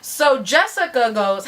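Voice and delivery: ordinary delivery, nothing marked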